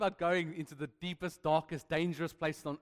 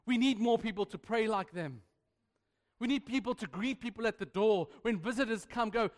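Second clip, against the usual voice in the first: normal talking voice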